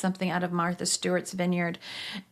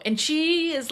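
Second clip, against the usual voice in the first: normal talking voice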